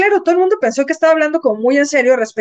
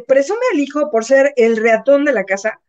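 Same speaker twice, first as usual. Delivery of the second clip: ordinary delivery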